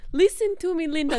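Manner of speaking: put-on high, pleading little boy; little kid voice. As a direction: lightly imitate a toddler begging mum. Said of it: "That little kid".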